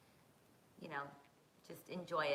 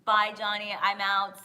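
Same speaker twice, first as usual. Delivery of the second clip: loud goodbye; 3 semitones higher, 13 dB louder, slower than usual. Real, not acted. Loud sign-off.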